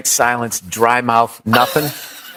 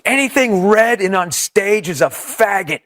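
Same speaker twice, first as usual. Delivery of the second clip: slow, higher mock yell without much volume; retold heckler yell; 4 semitones higher than usual. Heckler to comic on stage, slow mock yell. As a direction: imitate a heckler calling out, drawn out and pitched up. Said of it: "he just yells out".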